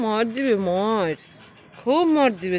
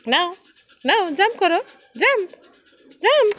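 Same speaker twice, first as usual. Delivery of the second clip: high, loud urging to jump; rising excited calls to the pet to jump; eager urging; 11 semitones higher, 6 dB louder than usual. Real, not acted.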